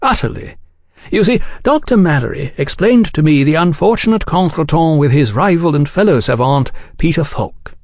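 high, lilting gentlemanly explanation; cordial gentleman journalist. A narrator's reading. Oliphant describing his book to the agents, cordial. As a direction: explain your work with smooth courtesy.

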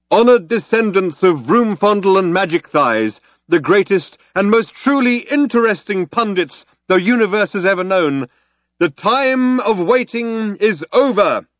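high-pitched ceremonial proclamation; rally the crowd like a grand orator; crying out. Acted. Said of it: "he cried out".

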